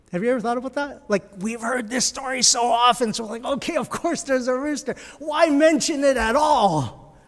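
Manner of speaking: preaching at full force. Acted. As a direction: drive the question home to the congregation. Preaching builds on the rooster.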